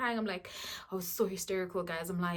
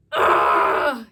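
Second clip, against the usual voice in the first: mock wail; 9 dB louder than usual. Mock wail retelling crying.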